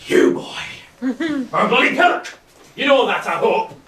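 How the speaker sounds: mock angry outburst. Father shouting insults at his poet son. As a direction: berate the lad with gusto.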